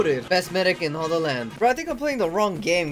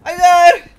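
high loud shout; 9 semitones higher, 14 dB louder than usual. Acted. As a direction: yell it across the battlefield. Shouting in battle game.

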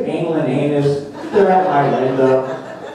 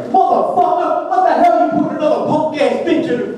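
gangster fish; 12 semitones higher than usual. Retold or imitated. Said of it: "he gets all gangster and shit".

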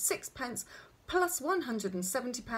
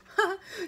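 short, high acted chuckle; doctor's brief loud "ha"; one quick amused laugh; 12 semitones higher, 5 dB louder than usual. A narrator's reading. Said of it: "The doctor laughed".

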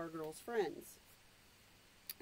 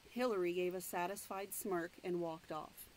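plain reading voice

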